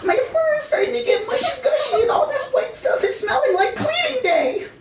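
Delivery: very high cartoon hamster voice, slowing at the end; squeaky grossed-out hamster. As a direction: whine it like a tiny fussy hamster. Hamster voiced complaining about fur.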